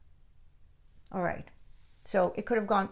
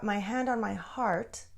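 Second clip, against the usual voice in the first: ordinary delivery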